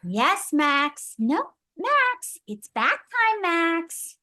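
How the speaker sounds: high, scolding big-sister singsong; Ruby voice. Pretend. Voice actress doing Ruby, high scolding Max.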